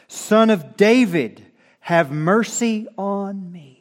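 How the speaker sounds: Bartimaeus cries out to Jesus for mercy, loud, high, drawn-out call; crying out. Acted. Blind beggar calling to Jesus from the road, crying out. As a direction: call out loudly and slowly, pitched high. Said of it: "began to cry out and say".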